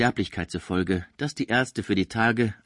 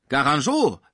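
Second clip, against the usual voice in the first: louder, sharp outburst at the rival's name; angry cry; 5 semitones higher, 5 dB louder than usual. A narrator's reading. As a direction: spit the name Garangeau out in anger. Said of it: "rief Pons wütend". (Pons ruft den Namen wütend)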